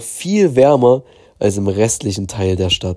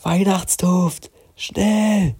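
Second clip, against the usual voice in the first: urgent slow whisper; 9 semitones higher, 3 dB quieter, much slower than usual. Acted. Mats urges the hidden creature, whispering. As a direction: hiss it quietly but urgently. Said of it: "flüsterte er".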